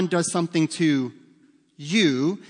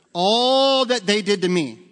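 put-on whiny kid; 4 semitones higher, 5 dB louder, slower than usual. Kid whining his story first.

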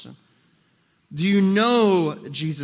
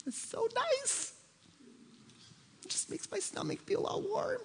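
sarcastic gushing voice; 13 dB quieter than usual. Pretend. Preacher mocking churchgoers moved by feelings, high sarcastic coo. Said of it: "I'm being sarcastic 'cause I'm trying to make a point".

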